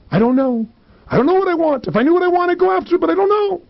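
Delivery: high-pitched protesting helplessness; exaggerated clueless complaint; whiny excuse. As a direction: plead ignorance in a high, rising whine. Acted excuse of people who won't answer.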